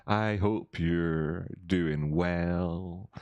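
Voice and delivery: slow drawn-out showman welcome; slow host greeting. Shown as a demonstration. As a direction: stretch each word like a slow TV host. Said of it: "How was that? Did that work?".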